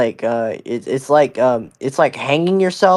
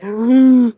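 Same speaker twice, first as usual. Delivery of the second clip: mock gasp; 12 semitones higher, 5 dB louder than usual. Mock shock at six seven.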